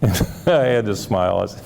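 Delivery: chuckling through the words; amused, touched laughter; laughing. Real, not acted. Recalls client's touching insight.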